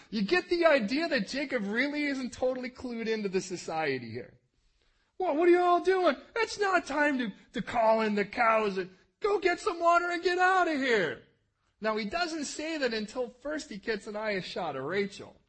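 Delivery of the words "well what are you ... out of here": high, loud, pushy yell; mock yell. Jacob yelling at the shepherds to hurry, loud. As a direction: hurry them off with a shout.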